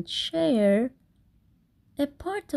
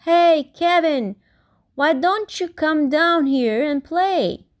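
loud cheerful call up the tree; 6 semitones higher, 5 dB louder than usual. Acted. Wombat calling up to Kevin in the tree, loud and cheerful. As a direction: shout up to him to come play. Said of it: "and shouted one day".

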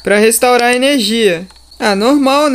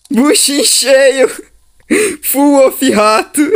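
creature voice; 3 semitones higher than usual. Pretend. Playing the fed creature.

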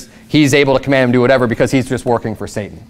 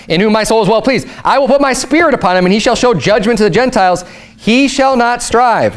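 much higher, proclaiming each phrase; fervent scripture reading; 9 semitones higher than usual. Shown as a demonstration. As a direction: lift the verse up like a herald. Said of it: "same scripture reread, raised emphasis".

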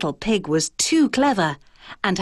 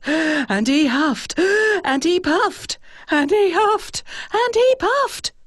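climbing higher with each huff; rising strain; 10 semitones higher than usual. A narrator's reading. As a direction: build the effort higher and higher. Huffing harder and harder.

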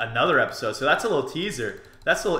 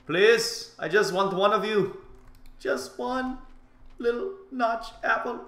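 high, small begging voice; pleading; 9 semitones higher than usual. Acted. Player begging his chest for a notch apple, pleading. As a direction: plead sweetly for just one, high and drawn out.

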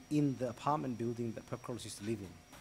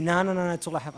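loud, higher denial; heated refusal; 6 semitones higher, 9 dB louder, faster than usual. Retold or imitated. Blind man refusing to hand over letters, loud. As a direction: shut him down firmly.

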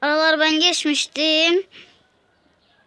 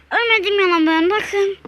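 excited; 6 semitones higher, 4 dB louder than usual. Real, not acted. Survives a near death.

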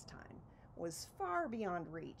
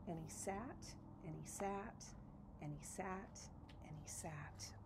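softer with each and he sat, down to a whisper; fading to a whisper; 9 dB quieter than usual. A narrator's reading. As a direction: let each repeat sink quieter until only breath.

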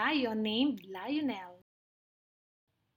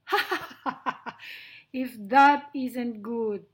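hearty acted laugh into amused words; laughing lion; 7 dB louder than usual. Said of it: "laughed Lionel".

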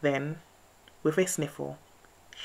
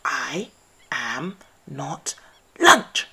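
spluttered; 8 dB louder, much slower than usual. A narrator's reading. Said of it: "spluttered Mouse".

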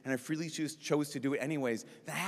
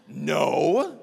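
hammed-up shocked denial, drawn out loud; 6 dB louder than usual. Sister asked if she killed anyone, mock shocked. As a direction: blurt it out in mock horror. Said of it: "She's all shocked".

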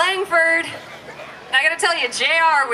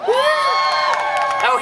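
surprised. Real, not acted. Host reacts to cheering section.

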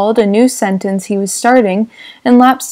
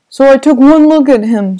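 put-on louder, gruff old gangster telling a story; Wolfsheim's voice; 4 semitones higher, 6 dB louder than usual. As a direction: lean into the old racketeer's brag. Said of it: "said Mister Wolfsheim".

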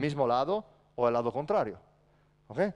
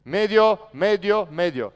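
loud court call that fades off; 4 semitones higher, 8 dB louder, slower than usual. Shown as a demonstration. Coach showing the call to a teammate, loud then fading. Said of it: "le dice".

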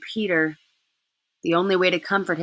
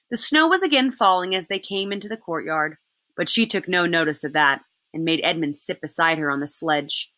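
ordinary delivery

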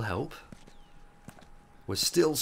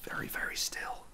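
hushed whisper near enemies; 10 dB quieter than usual. Real, not acted. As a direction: whisper so enemies won't hear.

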